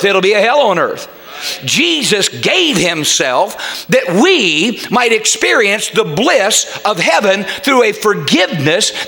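driving pulpit exhortation to the congregation; fervent preaching. Real, not acted. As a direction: preach it insistently, punching the key words. Preacher builds to forceful exhortation.

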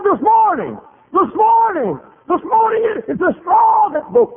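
very high, loud, repeated outcry; shouted last-straw outrage. Retold or imitated. Lady reaching her limit with the preacher, shouted outrage. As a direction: hammer it out at a shriek, then ease off.

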